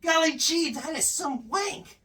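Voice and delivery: put-on high, soft, chirpy clean-cut kid voice; wholesome 1950s kid. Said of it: "the equivalent of "Hiya, pal".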